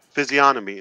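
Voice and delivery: "physiognomy" said as fizzy-onomy; no g at all in "physiognomy"; silent G. Shown as a demonstration. Host settling how it is pronounced, G silent. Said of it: "There's no G".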